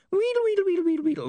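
very high wheedling gibberish; high squeaks dropping at the end; rewind squeal. Acted. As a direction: squeal like a tape winding back. Imp imitates rewinding noise.

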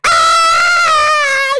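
high, loud burst of excitement; excited shout. Pretend. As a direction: cheer like a radio host going off. Imagined radio hype shout.

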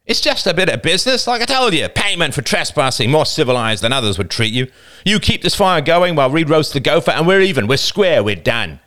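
put-on older boy setting the girls' payment for trespassing; older boy, cold bargaining. A narrator's reading. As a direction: lay out the deal like a petty boss. Older boy sets terms.

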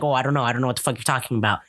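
low, fast, dismissive brush-off. Retold or imitated. Son brushing off his mother, low and fast. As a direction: rattle it off to shut her down.

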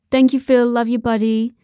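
chummy put-on British director; fake British accent. A narrator's reading. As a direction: thank him heartily in phony British. George's fake British voice thanking Phil.

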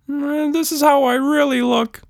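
high shy creature voice, mumbled; shy creature mumble. A narrator's reading. Bashful pink monster mumbling to Tilda. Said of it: "the creature mumbled".

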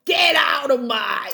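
mock Cockney shout.

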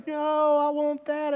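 high-pitched imitation of a tired toddler; whining toddler. Exhausted child refusing a parent's nap, mock high whine. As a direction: protest like an overtired two-year-old, pitched well up.

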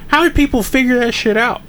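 pitched high in disbelief; incredulous rant. Real, not acted. To his friend about old games, high incredulous question. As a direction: ask it to the sky, baffled. Ranting about impossible puzzles.

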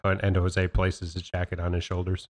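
low, hushed book-narrator read; audiobook narration. Shown as a demonstration. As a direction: narrate the action softly, like a novel. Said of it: "can we do it like a book".

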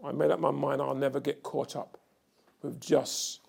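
loud, soaring preacher's cry; fiery revival preaching; preaching at full force. Acted. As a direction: preach it to the back row, building hard.

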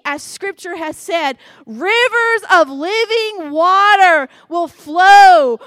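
preacher proclaiming Jesus' words aloud; loud proclamation. Acted. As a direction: call it out to a crowd, stretching the last words. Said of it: "said this in a loud voice".

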